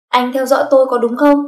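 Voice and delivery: loud, accusing challenge; confrontational. Acted. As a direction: demand an answer from a suspect. Confronting a man she suspects.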